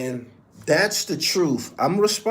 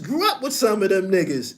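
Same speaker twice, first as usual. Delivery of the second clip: usual speaking voice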